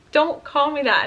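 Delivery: imitating an upset, insistent repeat. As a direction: raise pitch and volume, as if not heard the first time. Repeats louder, then starts bawling.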